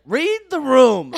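high, loud, drawn-out cry of disbelief; mock outraged shout; exasperated yell. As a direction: yell it at him like he's clueless. Yelling at homeless guy, retold.

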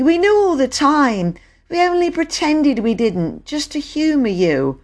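put-on high child's voice, lowering at the end; insistent girl. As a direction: own up insistently, pitched high. Bobby admits pretending, to Peter.

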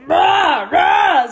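loud retold cries of bah; mock yelling. Friend yelling in his sleep at night, loud mock cries. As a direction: shout it like he did in his sleep. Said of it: "zo echt zo te gillen in zijn slaap".

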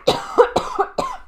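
a sharp real cough mid-sentence; coughing fit. Real, not acted. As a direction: cough hard, then carry on. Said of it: "Excusez-moi pour la toux". (quinte de toux)

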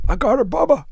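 put-on high, loud drunken coo over a beer can. Drunk mom cradling beer.

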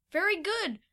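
high-pitched burst of praise; crying out. A narrator's reading. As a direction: cry out your approval. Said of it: "Fido cried out".

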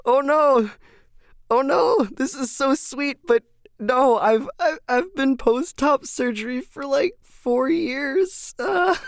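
high, flustered squeal of oh no; a hammed-up embarrassed freakout; mock panic. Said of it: "And I'm just sitting there like".

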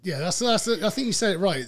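usual speaking voice

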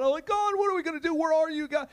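anguished plea, high and strained; lamenting cry to God. Acted. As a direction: voice jumps high in a pleading lament. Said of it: "he's lamenting".

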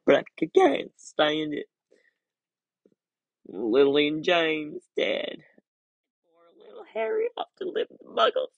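high, stammering, sobbing lament; tearful Hagrid. A narrator's reading. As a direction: giant weeping into his handkerchief. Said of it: "sobbed Hagrid".

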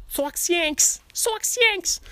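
very high, stretched Boston caricature; Boston accent. Acted. Baseball rivalry shorthand, high mock Boston accent. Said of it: "do a Boston accent".

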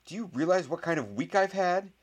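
high, loud, outraged question; indignant protest. A narrator's reading. Boy defends himself to gloating rival, indignant. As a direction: protest in exasperation.

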